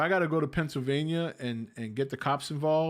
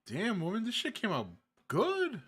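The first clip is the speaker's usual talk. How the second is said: impressed; 8 semitones higher than usual. Real, not acted. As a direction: pitch way up, delighted praise. Praising wife's sandwich.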